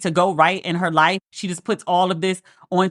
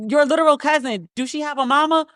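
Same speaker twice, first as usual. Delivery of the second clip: higher, incredulous questioning; a reviewer mock-interrogating the cousin, incredulous; 5 semitones higher than usual. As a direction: grill her like you can't believe it. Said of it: "Which I was like".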